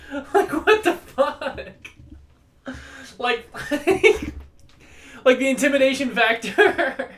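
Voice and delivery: words squeezed out through high-pitched laughter; barely getting words out for laughing; laughing hard. Real, not acted. Vlogger cracks up retelling the prank quote, laughing hard.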